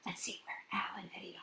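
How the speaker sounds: fast hushed whisper; secretive whisper. Real, not acted. Reader whispers aside.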